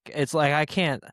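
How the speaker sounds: ordinary talk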